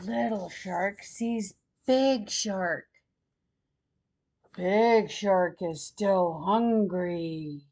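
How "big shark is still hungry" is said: plain reading voice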